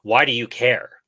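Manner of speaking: sharp, accusing challenge; hostile tone. Shown as a demonstration. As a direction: ask it like an accusation. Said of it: "let me try to imitate the tone".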